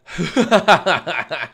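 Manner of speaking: loud, high burst of staccato laughter; loud cackle. Pretend. Streamer opening the cringy YouTube intro, loud off-putting cackle. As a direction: cackle loudly in a rapid rattle.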